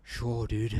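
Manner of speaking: ordinary delivery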